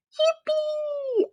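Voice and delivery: very high squeal of joy; yippee. Acted. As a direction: squeal with delight. Froggy celebra. (grito de alegría muy agudo)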